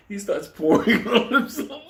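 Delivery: loud laughing while telling; laughing through words. Real, not acted.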